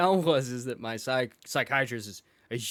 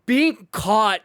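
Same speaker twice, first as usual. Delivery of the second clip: louder and slow, building to the joke; excited punchline; 4 semitones higher, 6 dB louder, slower than usual. Real, not acted. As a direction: land the big punchline. Punchline of the story, laughing.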